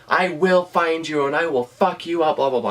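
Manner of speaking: imitating a cuffed man's raging threats; hostile yelling. Tim, handcuffed on the floor, threatening her with a high mock yell. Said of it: "He is screaming".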